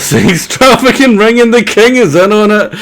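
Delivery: high, loud, laughing through the words; laughing guess. Real, not acted. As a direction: blurt out an absurd theory, cracking up.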